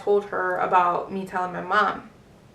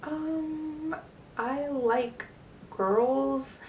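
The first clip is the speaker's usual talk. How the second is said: small, higher, softer voice; hesitant confession; 5 semitones higher, 8 dB quieter, much slower than usual. Retold or imitated. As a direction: confess it haltingly, softly, pausing before the last word. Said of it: "the way I said, I was like".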